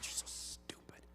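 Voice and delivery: hissed whisper of a scornful aside. Retold or imitated. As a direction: mutter it under your breath like a scolding voice. Shane scolding in an aside.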